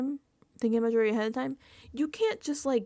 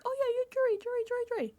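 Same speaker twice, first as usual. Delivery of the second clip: much higher singsong mock of careless players; mocking dismissive; much faster than usual. Players brushing Rachel off, mock high singsong. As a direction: toss off the jury jury jury mockingly. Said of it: "people keep being like".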